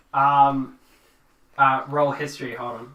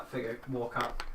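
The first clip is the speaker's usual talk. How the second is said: usual speaking voice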